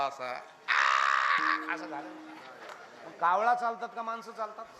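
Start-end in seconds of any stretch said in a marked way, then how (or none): 0.0-1.7 low, very slow strained croak; paralysed crow croak. Shown as a demonstration. Preacher acts out the paralysed crow, slow low croak. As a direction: croak like a crow with a twisted beak. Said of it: "असा पाय ओढीत चालतो बा कावळा".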